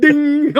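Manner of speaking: high, drawn-out ding; imitated ding. Telling co-host how the shot bounced, imitated ding. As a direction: make the sound of it pinging off.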